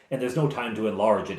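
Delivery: normal talking voice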